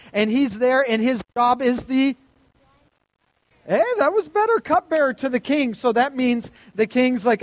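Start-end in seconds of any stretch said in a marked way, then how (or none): 3.7-4.6 sudden high-pitched real reaction; pleased surprise. Real, not acted. Teacher to students whose answer improved, pleasantly surprised. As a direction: jump up high, praising the group. Class answers louder after prompting.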